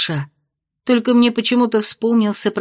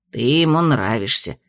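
low spirit voice; 9 semitones lower than usual. A narrator's reading. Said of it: "повторил хранитель".

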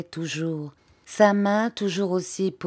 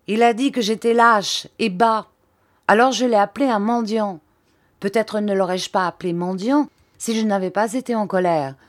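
put-on raised, heated boyish defiance; defiant; 5 semitones higher, 5 dB louder than usual. Pupil defying the headmaster, heated and proud. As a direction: stand up proudly, a bit fast. Steerforth defiantly defending himself.

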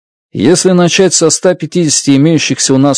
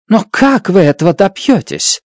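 insistent; 6 semitones higher than usual. A narrator's reading. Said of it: "настаивала Страт".

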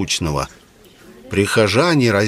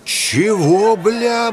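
high-pitched, stunned outburst; blurted disbelief; 11 semitones higher, much slower than usual. A narrator's reading. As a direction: let it slip out in shock. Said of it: "вырвалось у меня само собой".

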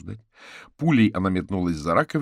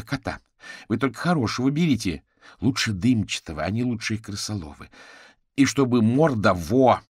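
loud, higher, enthusiastic urging; exclaiming; 5 semitones higher, 7 dB louder than usual. A narrator's reading. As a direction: urge them on loudly, building in emphasis. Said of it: "воскликнула вышедшая из кухни Маргарита". (громко, с восторженным напором)

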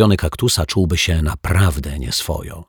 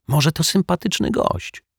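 high-pitched, tentative benefit of the doubt; high, well-meaning maybe; hopeful suggestion; 11 semitones higher than usual. A narrator's reading. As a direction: offer it lightly, pitched well up. Detective hopefully defends the newcomer.

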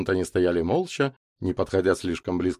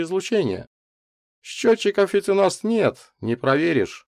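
raised character voice weighing doubts; hesitant scientist; 8 semitones higher, 4 dB louder than usual. A narrator's reading. Микульский explains his doubts to Котов, hesitant. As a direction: reason it through, higher than narration.

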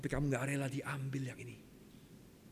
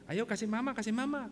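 lightly acted higher-pitched coaxing; coaxing parent; 8 semitones higher than usual. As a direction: coax a small child, pitched up. (membujuk anak kecil dengan nada tinggi)

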